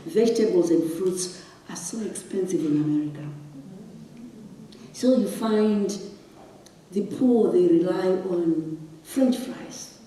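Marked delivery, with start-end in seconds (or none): none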